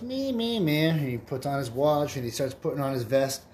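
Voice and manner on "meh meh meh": high nasal-free bleating meh; koala bleat. Acted. Koala answers the prostitute with a high bleat. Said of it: "the koala bear goes".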